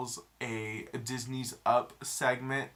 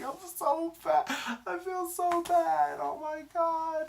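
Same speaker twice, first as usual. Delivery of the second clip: squeaky much higher and louder, dragged slowly; whiny high mock self; 6 dB louder, slower than usual. Imagining herself sobbing over Rogue One, squeaky whine. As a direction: play yourself as a whimpering overemotional moviegoer. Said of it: "I'd be like".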